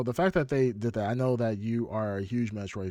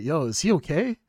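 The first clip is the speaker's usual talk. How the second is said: higher, louder retold question; imitated wife's concern; 5 semitones higher, 6 dB louder than usual. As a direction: ask it as a puzzled wife, retold. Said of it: "She's like".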